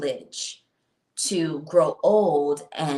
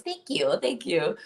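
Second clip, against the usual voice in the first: usual speaking voice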